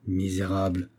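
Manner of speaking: an officer seething at an insolent soldier; furious grumble. A narrator's reading. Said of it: "grommela le Saxon furieux".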